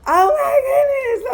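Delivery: high, loud burst of joy; overjoyed cry at his call; excited squeal. Retold or imitated. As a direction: gush with sudden delight. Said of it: "I was excited".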